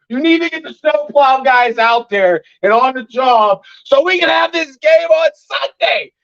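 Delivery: high, strained ranting, slowed on the demands; pleading, high-pitched frustration; worked-up rant. Real, not acted. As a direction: demand the plows like you're fed up. Ranting about snowplow guys.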